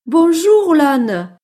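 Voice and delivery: high, loud mouse greeting; cheerful little mouse. Acted. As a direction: call hello as a little mouse. (petite souris qui salue, voix aiguë)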